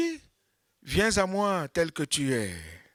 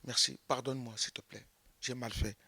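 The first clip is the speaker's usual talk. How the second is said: much softer, humble answer; meek apology; 5 semitones lower, 12 dB quieter than usual. Acted. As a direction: say sorry very softly. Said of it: "tu dis".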